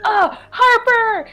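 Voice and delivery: high overwhelmed voice. Acted. Greeting Meow Wolf and Harper, high and teary. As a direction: choke out praise to the lord. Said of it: "I go".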